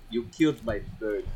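put-on soft Russian-villain accent from Iron Man two; quiet impression of a film villain's Russian accent; Mickey Rourke. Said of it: "Iron Man two with Mickey Rourke".

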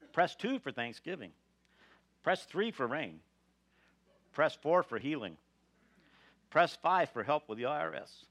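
recorded menu voice, bright announcer; phone menu voice. Retold or imitated. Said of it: "I can just hear Jesus' voice now".

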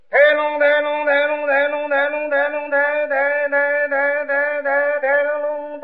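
rhythmic vocal gongs and drums, steady and chanted; mouth percussion. Pretend. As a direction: beat out the opera band with your voice. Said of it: "我给您打家伙".

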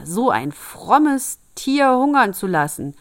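loud, high, slow outburst; scandalized tailor crying out; outraged cry. A narrator's reading. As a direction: draw out the indignation, loud and high. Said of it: "schrie der Schneider".

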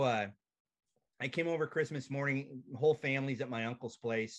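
normal talking voice

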